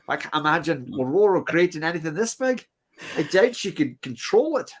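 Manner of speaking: put-on a light stab at an Irish accent reading Banshee; Banshee's speech in a mild mock Irish accent. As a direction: read Banshee's line in a hammed-up Irish accent. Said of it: "That was my best Irish accent".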